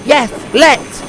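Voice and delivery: high, eager agreement; excited. A narrator's reading. Percy agrees to race Harold, excited. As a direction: jump at the idea. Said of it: "said Percy excitedly".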